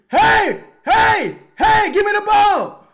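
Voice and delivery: high, loud desperate call, hey hey; calling for the ball. Retold or imitated. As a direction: shout like the open man begging for it. Voicing player calling for ball.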